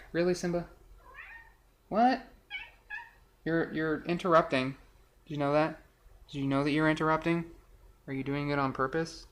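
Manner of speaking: soft aside to an interrupter; quiet scolding. Real, not acted. Speaker asks Simba about interrupting, quiet mild scolding. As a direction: turn away and chide softly.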